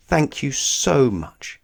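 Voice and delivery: slow, heartfelt thank you; touched gratitude to the helpers; grateful thanks. Acted. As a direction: thank everyone sincerely.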